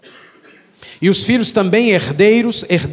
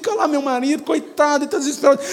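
higher, mock-worried fussing; fretting wife in heaven; 8 semitones higher than usual. A wife in heaven fretting over her widowed husband, mock-anxious.

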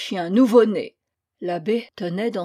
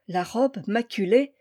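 plain reading voice

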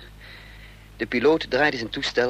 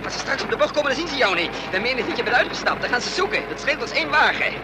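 higher, fast, breathless planning; tense and keyed-up; 10 semitones higher, faster than usual. Acted. Driver explaining the plan mid-chase to Lillet: tense, keyed-up. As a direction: rattle off the plan under pressure.

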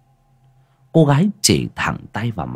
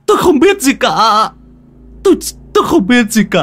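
high, loud, stammering and near crying; terrified pleading; 6 dB louder than usual. Acted. Frightened husband denies everything to the ghost, high and tearful. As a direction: babble in panic, begging. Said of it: "Thịnh nghe đến đây thì ú a ú ớ".

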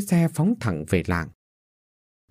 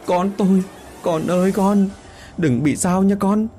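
wailing; 7 semitones higher than usual. A narrator's reading. Mother wailing for her injured daughter, anguished. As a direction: cry out for her child in despair. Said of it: "tay ôm mặt gào lớn".

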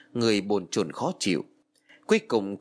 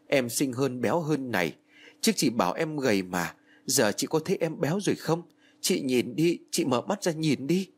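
higher, tearful pleading at the coffin; pleading with dead sister; 4 semitones higher than usual. Acted. Girl at her sister's coffin, pleading tearfully. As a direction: beg her sister to come back.

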